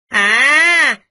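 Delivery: a sudden, loud, sharp "À!"; sharp angry exclamation. Acted. Ba Cam snaps at the young master with a loud exclamation. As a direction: bark it out in anger.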